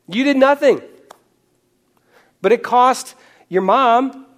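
fervent preaching. Acted. Pastor urging the congregation about grace, in fervent preaching. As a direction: drive the point home to the congregation.

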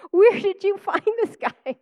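high, slow, amazed coworker with a laugh; mock-amazed office gossip; impressed coworkers. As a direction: gush like a stunned coworker. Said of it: "they were like".

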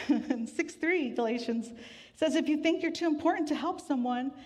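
ordinary talk

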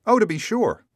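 high, loud exclamation; exclaiming. A narrator's reading. Bystanders answer Rip's question, high exclamation. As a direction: pipe up loudly in recognition. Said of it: "exclaimed two or three".

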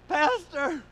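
high, loud imitated cry; distressed caller. Troubled caller crying out to dad, loud and high. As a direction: call out for help in imitation. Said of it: "the guy in the other one's like".

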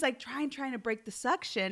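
ordinary talk

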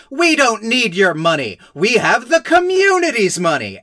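proudly refuse, hammed up and high; mock grandstanding grandpa. Podcaster mimics Grandpa refusing the banker's money, mock grandstanding. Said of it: "Grandpa Dime Store F D R is like".

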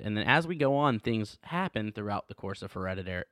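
ordinary talk

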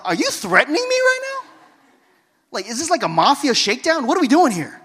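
mock outrage at being threatened; incredulous outburst. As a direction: blurt it out, loud and shocked. Retold indignant reply to threat.

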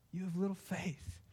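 soft, tender rebuke to Peter; gentle Jesus. Acted. Jesus gently correcting the sinking Peter, soft and tender. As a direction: lay it down gently, almost a hush. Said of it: "because inflection matters".

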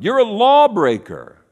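high, loud, drawn-out charge; accusers. Acted. Pharisees charging Jesus, high loud accusation. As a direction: pitch up and stretch the accusation. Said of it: "they would say".

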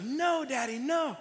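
put-on high-pitched little-kid refusal; imitating toddler's protest. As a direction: mimic an exasperated small daughter. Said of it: "she's just like".

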